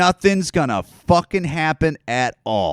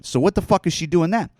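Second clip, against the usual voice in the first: usual speaking voice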